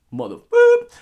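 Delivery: sudden loud mock curse with a high beep; hammed-up movie-hero cussing; Mace Windu. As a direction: burst out swearing, censoring it with a squeaked boop. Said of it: "in the voice of Mace Windu".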